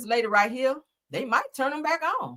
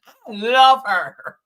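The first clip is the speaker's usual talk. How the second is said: drawn-out adoration; 8 dB louder, much slower than usual. Real, not acted. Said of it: "that made me so excited".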